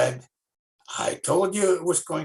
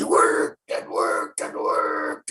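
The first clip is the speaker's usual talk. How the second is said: slow, low, strained pushing voice; groaning under a heavy rock; straining effort; 11 semitones lower, 3 dB louder, much slower than usual. Acted. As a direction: strain through every worked.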